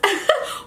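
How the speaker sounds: short, high giggle; laugh. Real, not acted. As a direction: giggle at your own story. Amused by her own story.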